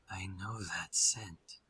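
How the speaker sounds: slow, very soft whisper; wary whisper. Acted. Werewolf smelling a familiar scent, whispering. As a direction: breathe it out slowly under the breath.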